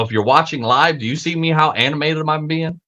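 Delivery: ordinary talk, nothing marked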